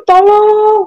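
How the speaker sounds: high, drawn-out plea; mimicked cry of "help"; retold call for help. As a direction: call out for help, lightly acted. Retold woman calling for help.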